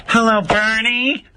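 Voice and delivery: put-on slow, high old man greeting. Comic voicing the old host answering a call, slow old man. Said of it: "that's what he sounded like".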